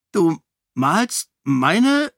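baffled. Acted. King baffled that she copies his paintings, slow and disbelieving. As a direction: stretch it out in disbelief. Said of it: "mit verblüffter Miene".

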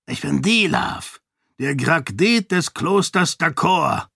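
high, imperious monk voice; a fanatic priest giving orders, pitched high; zealous monk. A narrator's reading. Dilaf introduces himself. (hohe, herrische Mönchsstimme)

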